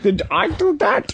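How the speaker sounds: high, slow mock magician voice. Friends riffing on the clip, high slow mock magician. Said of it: "Egyptian magician".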